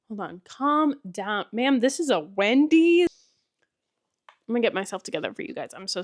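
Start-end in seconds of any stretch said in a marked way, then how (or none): 1.5-3.1 a higher, louder deadpan meme voice; mock cashier retort. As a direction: scold yourself like a fed-up cashier. Meme line to herself.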